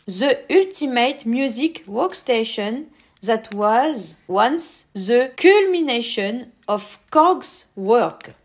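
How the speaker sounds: slow, careful English with a French accent. Real, not acted. Presenter reads the pack description himself, slow French-accented English. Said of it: "désolé pour mon accent anglais".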